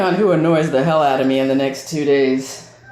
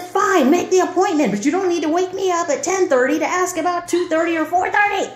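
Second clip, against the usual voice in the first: angry retort; 13 semitones higher than usual. Retold or imitated. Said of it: "I said".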